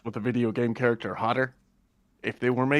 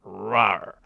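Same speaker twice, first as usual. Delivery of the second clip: mock tiger roar; 3 semitones lower than usual. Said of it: "like a tiger in heat".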